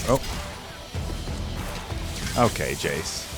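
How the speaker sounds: ordinary talk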